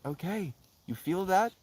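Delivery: high, soft questioning voice; self-coaxing. Retold or imitated. Talking to one's own tensed-up anger, gently coaxing. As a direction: coax gently upward, then let go on "release". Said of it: "And then I was like".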